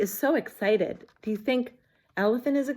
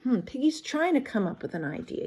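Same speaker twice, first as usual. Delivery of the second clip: ordinary delivery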